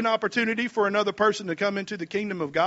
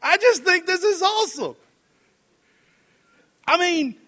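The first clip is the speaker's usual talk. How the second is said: delighted; 11 semitones higher, 4 dB louder than usual. Real, not acted. Delighted exclamation after laughing.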